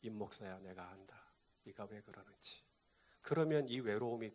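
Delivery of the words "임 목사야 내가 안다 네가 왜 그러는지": low, very soft, understanding voice; quietly reassure, dropping almost to a murmur; 하나님. Acted. God speaking to the pastor, hushed reassurance. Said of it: "하나님이 우리 임 목사님한테 그래요".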